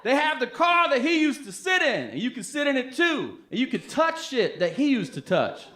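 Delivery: hammed-up outrage in a squeaky high register; put-on excited voice. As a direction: burst into a high-pitched, over-excited impression. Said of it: "Why is he doing the voice like that".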